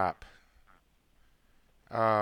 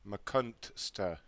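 usual speaking voice

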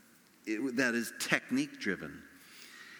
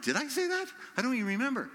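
high-pitched, puzzled self-quote; baffled disbelief; 12 semitones higher, faster than usual. Retold or imitated. Preacher replying to a moved listener after his sermon, baffled disbelief. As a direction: act out being confused by the credit.

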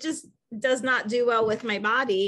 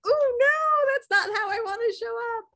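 dismayed; 9 semitones higher than usual. Retold or imitated. Dismayed at herself.

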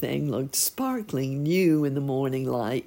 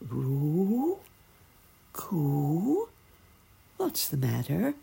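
gentle dove; 3 semitones higher, much slower than usual. A narrator's reading. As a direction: coo it, then ask kindly. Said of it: "asked a gentle voice".

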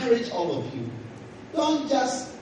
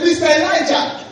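loud, raised call of Mister Elijah; retold shout; 7 semitones higher, 8 dB louder than usual. Preacher retelling Elisha calling out to his master, loud shout. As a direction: cry out the name like a servant calling his master. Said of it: "he shouted".

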